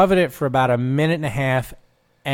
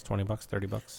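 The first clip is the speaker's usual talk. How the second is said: auctioneer; 4 semitones lower, 11 dB quieter than usual. Retold or imitated. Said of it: "You sound like an auctioneer there".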